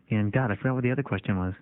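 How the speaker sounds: ordinary talk, nothing marked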